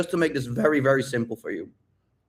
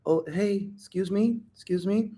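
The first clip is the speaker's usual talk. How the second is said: soft, higher-pitched timid approach; small voice; 6 semitones higher, 5 dB quieter than usual. Acted. Coach imitating a shy guy approaching a girl, small timid voice. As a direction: make yourself small, quiet and tentative. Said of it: "if someone has a small voice".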